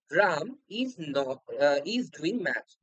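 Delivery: very slow, each word spaced out; slow dictation. Shown as a demonstration. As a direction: read the example slowly for students. Teacher reads example sentence. (খুব ধীরে উদাহরণ পড়া)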